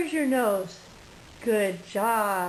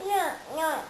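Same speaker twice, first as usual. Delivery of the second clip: a lightly voiced kitty meow; gentle little cat sound; 6 semitones higher than usual. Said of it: "that's a kitty cat".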